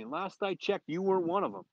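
high, rising-force retold scolding; mock yelling. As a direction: drive home the coach's put-down. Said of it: "Pat starts yelling at me".

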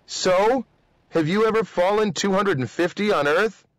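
high-pitched challenge; high, argumentative query; protesting. Acted. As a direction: push back with a mildly incredulous question. Cadet argues against the jump.

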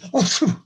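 loud imitated sneeze; lion's sneeze. A narrator's reading. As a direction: fake a big lion sneeze. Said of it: "they heard a lion sneeze".

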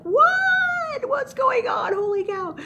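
high, loud shout of shock; hammed-up freak-out. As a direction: yell in comic panicked disbelief. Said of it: "I think I'd freak out like".

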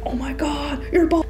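high, hushed gasp of disbelief; breathy acted astonishment, rising from near-whisper; mock shock. Man shocked at her baldness.

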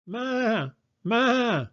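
very high goat bleat. Acted. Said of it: "said the goat".

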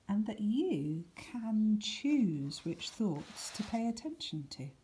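ordinary delivery

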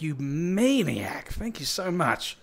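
ordinary talk, nothing marked

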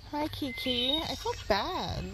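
very soft greeting to a timid animal; soft, tender pity. Real, not acted. Walker beside a scared stray cat, soft tender pity.

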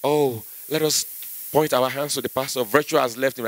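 lightly voiced calm preacher; soft American preacher. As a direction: play the calm American pastor. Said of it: "pastors preach in America".

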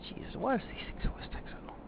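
breathless whispered groan; exasperated whisper. Acted. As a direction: hiss it out in frustration. Frantic while plane glides down.

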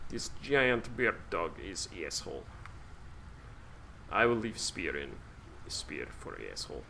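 broken English in a hammed-up Russian accent; mock Russian accent. Player riffing on the bear dog in a mock Russian accent. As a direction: play a gruff Russian hunter, half joking. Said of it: "That's good accent".

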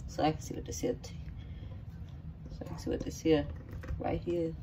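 whispered to herself. Real, not acted. Sneaking a taste from the bowl.

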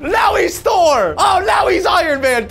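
hyped shouts at every transformation; excited shouting. Real, not acted. Watching hero transitions, shouting in excited amazement. Hype reaction to superhero transitions.

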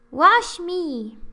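high, loud put-on voice; acted meme line. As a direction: plead it like the meme.

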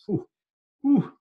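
exhaled mock-shocked whew; high whistly whew of dismay. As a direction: blow out a "whew" at the thought. Reacting to imagined violence.

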